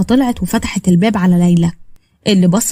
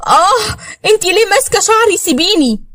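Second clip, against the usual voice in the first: high-pitched cry of pain; acted squeal from a hair pull; pained protest; 11 semitones higher than usual. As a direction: yelp and plead to be let go. Rival's hair is being pulled.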